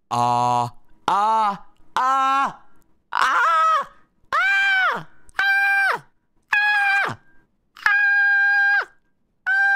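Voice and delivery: ahs climbing to very high and loud; rising ah scale. Shown as a demonstration. Streamer testing mic with a climbing loud ah scale. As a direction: sweep up a siren of ahs. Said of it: "mic test scale".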